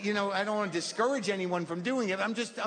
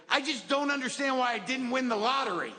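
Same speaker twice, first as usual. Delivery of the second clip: baffled dad; 8 semitones higher than usual. Retold or imitated. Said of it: "And he goes".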